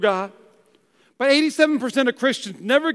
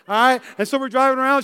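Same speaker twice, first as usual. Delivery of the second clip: ordinary delivery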